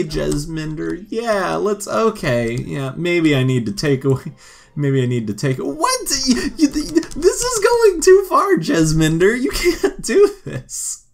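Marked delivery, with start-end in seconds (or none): 5.6-11.0 shrieking much higher and louder, laughing at first; indignant shriek at an intruding friend; high, loud outraged squeal. Pretend.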